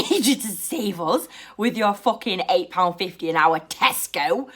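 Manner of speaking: usual speaking voice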